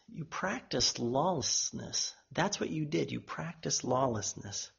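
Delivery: very soft, grave pronouncement; hushed, weighty condemnation; solemn verdict. Acted. Jesus' verdict, voiced and repeated solemnly.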